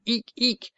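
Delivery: high little owl squeaks of glee; excited owl eek. Acted. Plop, fluttering round the scouts' heads, squeaks high with excitement.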